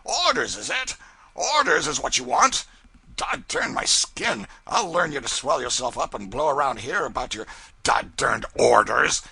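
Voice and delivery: loud, high sarcastic tirade building to a roar; mock him, then blow up; mocking rant. A narrator's reading. Furious pilot mocking the cub's demand for orders, loud and high. Brown ranting mockingly at cub.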